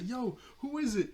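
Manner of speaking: happy, eager. Retold or imitated. Bo asks who came, so happily. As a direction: imitate bursting anticipation. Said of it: "He was so happy".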